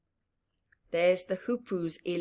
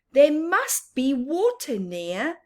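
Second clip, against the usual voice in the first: normal talking voice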